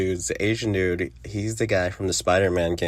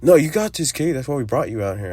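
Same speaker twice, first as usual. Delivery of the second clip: mock insistent urging; 6 semitones higher, 4 dB louder, faster than usual. Reviewer mimicking Javi pushing Kate onward, insistent. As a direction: burst in high and loud, then settle.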